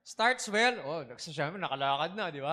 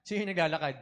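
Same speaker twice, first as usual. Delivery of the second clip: normal talking voice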